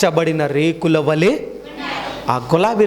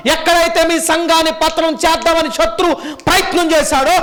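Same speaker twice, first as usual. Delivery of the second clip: high, loud preaching voice; 12 semitones higher, 3 dB louder than usual. Acted. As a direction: preach it out with rising fervour. Preaching at full force.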